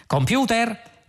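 high, slow, drawn-out call. A narrator's reading. Zaphod summoning the ship's computer, calling out. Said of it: "chiamò Zaphod".

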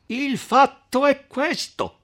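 high, slow, emphatic cry; starting to shout. A narrator's reading. Count Attilio seizing the question, shouting. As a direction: cut in high, each word drawn out. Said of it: "cominciava a gridare il conte Attilio".

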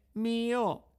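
high, slow, meek reply; barely dares to answer; timid puppet. A narrator's reading. Pinocchio answers the officer, meek and high.

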